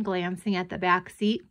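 usual speaking voice

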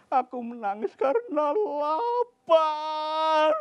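put-on high, slow, loud whining child; whiny child. Gundul telling the villagers he is hungry, high whine. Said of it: "kata si Gundul".

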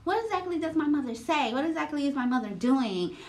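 high, fast feigned confusion; imitating her playing dumb; mock innocent. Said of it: "act like she just don't know".

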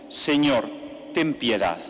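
ordinary talk, nothing marked

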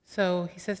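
ordinary delivery

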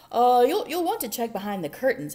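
loud, quick stammer; nervous bear. A narrator's reading.

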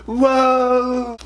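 high, drawn-out wobbling whoa; startled whoa. Retold or imitated. Fan slipping on the tour ramp, high startled whoa. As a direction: yelp as the ramp tips you.

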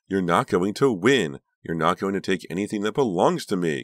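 high, loud, possessive threat; hoarse angry cry. A narrator's reading. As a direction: cry out in fury at a rival. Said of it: "Karina cried hoarsely".

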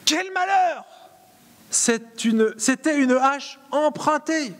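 high, loud wail of dismay; acted despair. Disciple laments to Élisée over the borrowed axe. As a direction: shout the loss, slowing at the end. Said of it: "Je le joue".

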